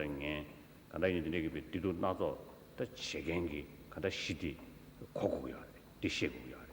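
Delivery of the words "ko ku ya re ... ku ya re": ordinary talk, nothing marked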